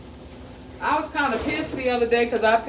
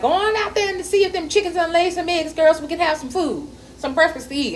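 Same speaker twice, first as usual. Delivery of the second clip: higher, louder country-mother call; imitating mama; 9 semitones higher, 4 dB louder than usual. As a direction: send the girls out like a Texas mama. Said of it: "my mama used to be like".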